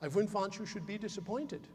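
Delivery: put-on soft Russian-accented grandmother. Grandmother answers teenage grandson's big idea, soft Russian accent. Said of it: "she would say to me in that delightful Russian accent".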